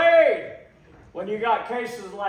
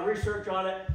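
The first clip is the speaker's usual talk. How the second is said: ordinary talk, nothing marked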